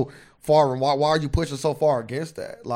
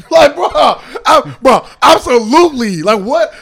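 laughing disbelief; 8 semitones higher, 11 dB louder than usual. Real, not acted. Reacting to "whoop him.

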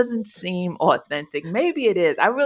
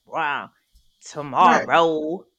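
slow, drawn-out New York vowels; New York accent, stretched words; thick New York accent; 2 semitones lower, slower than usual. Shown as a demonstration. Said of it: "you got a thick New York accent".

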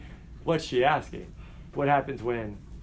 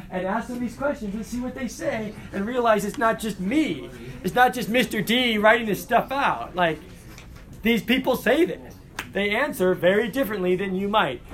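imitating a keen student, higher and louder; eager student; 7 semitones higher, 3 dB louder than usual. Student excited about interviewing neighbors, imitated eagerly. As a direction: play the student who can't wait to go. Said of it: "then you're like".

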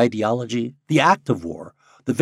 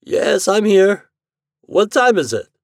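sleepy; 13 semitones higher, 9 dB louder, slower than usual. Acted. Lee just woken from sleep.

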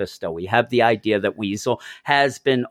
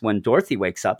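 normal talking voice

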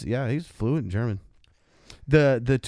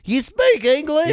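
high, loud clueless tourist voice; mock a lost American abroad; dumb American tourist; 8 dB louder than usual. Mocking an American tourist at Oktoberfest, loud and high. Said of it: "dumbass American".

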